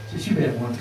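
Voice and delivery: usual speaking voice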